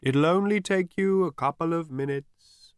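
high, airy, far-away call; as if receding out of earshot; faint distant voice. A narrator's reading. Voice reassuring Zaphod from afar, faint. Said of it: "said the voice very faintly".

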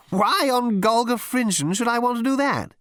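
high-pitched, puzzled captain; bemused captain. A narrator's reading. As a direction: ask it high, in sheer bemusement. Bemused captain answers his officer.

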